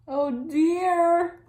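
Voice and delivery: high, loud, squealing oh dear in a different voice; pig's loud high dismay. Acted. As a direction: wail it as the horrified pig. Paint spills on Edward.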